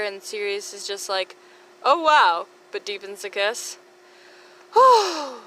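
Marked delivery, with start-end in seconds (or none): 1.8-2.5 surprised. Acted. Sirius surprised by kiss. 4.7-5.3 high loud whew after a long breathless read; puffed-out whew; relieved exhale. Real, not acted. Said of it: "I said that all in one single breath".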